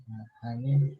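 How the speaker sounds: hushed aside while a call comes in; whispering on the phone. Real, not acted. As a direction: drop to a low murmur. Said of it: "ada telepon ini".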